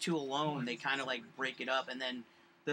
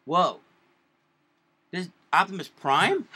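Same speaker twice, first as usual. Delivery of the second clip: mock surprise; 2 semitones higher, 7 dB louder, slower than usual. Host meets guest in costume.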